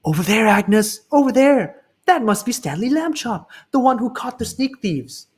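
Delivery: high, excited gossiping voice; a passerby telling Agnes about Stanley. Retold or imitated. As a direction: point him out to a friend. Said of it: "He could hear them whisper".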